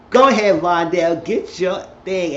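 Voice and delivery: loud, high, stretched-out cheer; cheering her on. Retold or imitated. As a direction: holler encouragement at a girlfriend. Teller cheering the character on.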